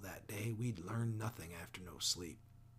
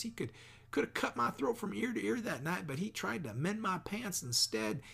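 much higher, rushed and pleading; frantic pouring out; 10 semitones higher, 3 dB louder than usual. A narrator's reading. Jem begging his father, high frantic plea. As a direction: spill every secret in a panic to save him. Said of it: "He began pouring out our secrets".

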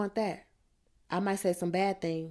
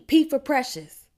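high, loud, drawn-out holler; 8 semitones higher, 8 dB louder than usual. A narrator's reading. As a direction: holler it out as your own letter comes up. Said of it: "I hollers".

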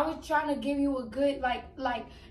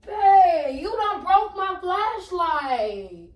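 dismayed whine; 4 semitones higher, 3 dB louder, much slower than usual. Real, not acted. Shocked at broken flashlight.

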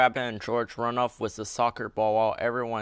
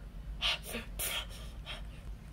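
tired sigh; 11 dB quieter than usual. A narrator's reading. George tired of fetching.

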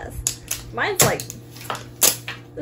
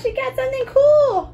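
excited shout; 11 semitones higher, 4 dB louder than usual. Real, not acted. Excited calling out.

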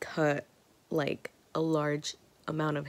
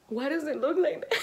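high laughing shocked cry, louder; 9 semitones higher, 6 dB louder than usual. Real, not acted. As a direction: disbelief at the mirror. Shocked at the result again.

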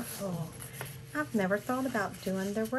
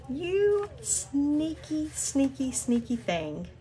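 teasing; 6 semitones higher, 3 dB louder than usual. Real, not acted. Teasing the friend.

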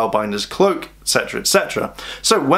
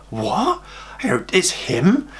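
surprised; 5 semitones higher, slower than usual. Retold or imitated. Riley surprised Chase found it.

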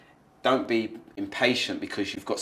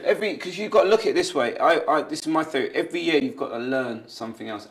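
normal talking voice

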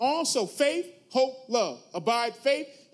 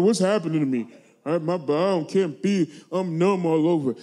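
low, loud voice of alarmed bodily distress; stricken sufferer; 5 semitones lower, 5 dB louder than usual. Acted. Preacher mimics an overeater whose body is failing, distressed and low. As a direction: act out someone losing feeling in their body.

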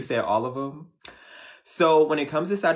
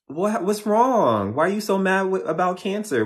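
mock surprise; 8 semitones higher, 3 dB louder than usual. Reacting to angry viewer comments, mock surprised. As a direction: play up being baffled by the comments.

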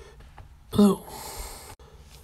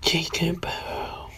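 evil voice; 4 dB quieter than usual. Acted. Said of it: "this is my evil voice".

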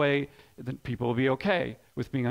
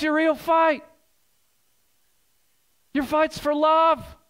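higher, louder, slowing down on the key lines; full-force preaching; 10 semitones higher, 4 dB louder than usual. Acted. Pastor urging his congregation, preaching at full force. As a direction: preach it hard, driving each point home.

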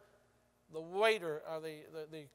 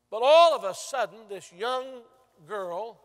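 sudden loud burst; 3 semitones higher, 12 dB louder than usual. Real, not acted. Preacher's dramatic turn in story.

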